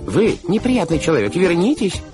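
high, loud scolding call; outraged command; indignant shout. Acted. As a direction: shout through a barrier at a scoundrel. Banker shouting through the gate.

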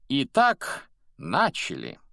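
high, loud cue, then dropping; calling the start. Acted. Headmaster starting the school anthem, called out. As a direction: launch a choir with a call. Dumbledore cues the school to sing.

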